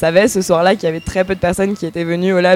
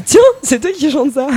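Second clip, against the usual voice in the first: surprise; 6 semitones higher, 4 dB louder than usual. Retold or imitated. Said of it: "on s'est dit".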